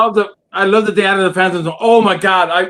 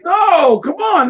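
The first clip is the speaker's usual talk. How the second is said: mock shout; 7 semitones higher, much slower than usual.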